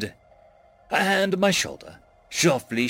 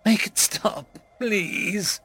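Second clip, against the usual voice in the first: sobbing plea; 7 semitones higher, much slower than usual. A narrator's reading. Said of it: "I sobbed".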